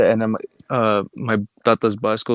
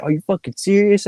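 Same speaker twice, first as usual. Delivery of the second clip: higher-pitched incredulous question; mock disbelief; 9 semitones higher, 4 dB louder than usual. Grandma hearing the fake Trump news, mock disbelief. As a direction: imitate a stunned relative, pitch lifted.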